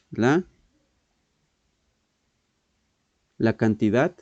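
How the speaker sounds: word by word, long pauses between words; slow dictation. Shown as a demonstration. Teacher dictating the definition to students, slow and spaced. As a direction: dictate it so students can copy. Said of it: "Esta va a ser de como diccionario".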